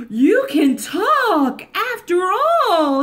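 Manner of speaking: slow, loud astonished cry; the bear can't believe his ears; amazed bear. Acted. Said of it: "he cried".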